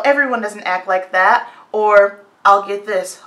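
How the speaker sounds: normal talking voice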